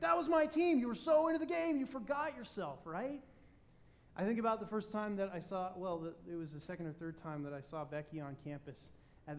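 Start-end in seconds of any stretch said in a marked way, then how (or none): 0.0-3.2 excited fan. Retold or imitated. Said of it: "you're like".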